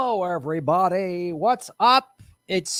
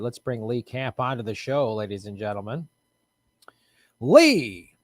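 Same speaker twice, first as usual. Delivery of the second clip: ordinary talk, nothing marked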